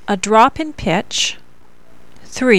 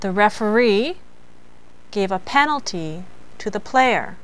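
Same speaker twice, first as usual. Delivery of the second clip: "referee" rising, pause, then falling to "player"; same melody and rhythm; 5 dB quieter than usual. Shown as a demonstration. Teacher repeats the referee sentence, pausing after "referee", falling on "player". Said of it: "with the same melody and rhythm in mind".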